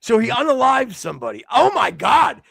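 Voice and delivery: high, loud cry of mock despair; exasperated shouting. Host has slipped up on forbidden words, yelling in exasperation. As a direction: wail it as if all is lost.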